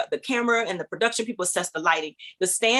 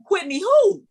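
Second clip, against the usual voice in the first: high, loud incredulous question; stunned disbelief; 11 semitones higher, 6 dB louder, slower than usual. Retold or imitated. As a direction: ask it as if floored, pitched way up. Retold disbelief at the name.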